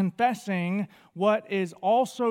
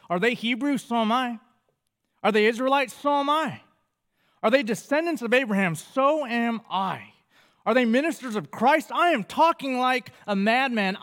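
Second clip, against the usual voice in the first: rising, louder, heated boasting; 4 semitones higher, 3 dB louder than usual. Acted. Preacher voicing Paul's angry boast, louder and higher. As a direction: build each question into a cocky challenge. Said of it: "Hear that tone in my voice".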